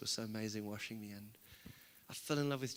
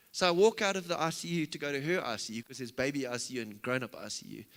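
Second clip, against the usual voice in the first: ordinary delivery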